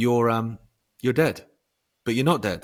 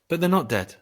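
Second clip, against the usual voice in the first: higher, louder objection, imitating her shock; retold disbelieving protest; 8 semitones higher, 5 dB louder than usual. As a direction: mimic an alarmed objection, pitched up. Acted protest to the consultant.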